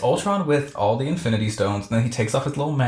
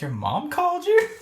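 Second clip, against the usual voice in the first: much higher incredulous squeal; mocking disbelief. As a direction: tease him that his mom called. Captain Marvel mocks Thor.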